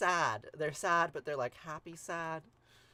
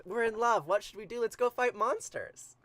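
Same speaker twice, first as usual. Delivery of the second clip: higher, louder eager gush; giddy excitement; 6 semitones higher, 8 dB louder than usual. Pretend. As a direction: bounce with excitement. Gilgamesh gushing, proposing quest.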